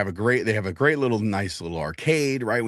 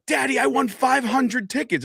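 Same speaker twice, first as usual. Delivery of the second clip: put-on high, slow, proud kid voice; excited little girl; 13 semitones higher than usual. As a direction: announce it like a child who just won big. Daughter announcing her win.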